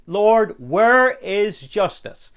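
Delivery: loud, high, drawn-out demand to God; acted prophet's protest; anguished cry. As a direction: cry it out slowly, word by word. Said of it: "His cry is".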